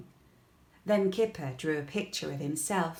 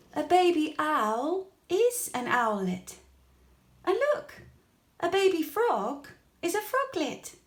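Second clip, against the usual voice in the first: excited discovery; 10 semitones higher, 5 dB louder than usual. A narrator's reading. Kipper reads from computer.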